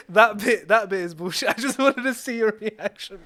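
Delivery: high, giggly admission through real laughter; laughing confession. Real, not acted. Storyteller admitting he made that bit up, laughing. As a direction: own up to the prank while cracking up.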